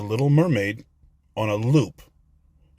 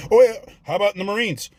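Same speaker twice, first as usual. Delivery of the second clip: ordinary talk, nothing marked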